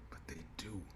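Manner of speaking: whispered, grim. Acted. Answering her scripture quote with a grim whisper. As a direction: breathe it out barely voiced.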